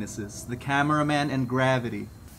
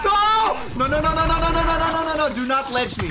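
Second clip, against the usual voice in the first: high, rapid-fire panicked nos; panicked pleading; 12 semitones higher, 3 dB louder than usual. Real, not acted. Gamer about to be knocked off a ledge, panicked pleading. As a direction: blurt it high, begging it to stop.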